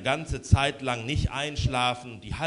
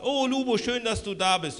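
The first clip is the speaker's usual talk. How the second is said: warm welcome; 7 semitones higher than usual. Acted. Mother greets son at the door.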